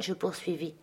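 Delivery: usual speaking voice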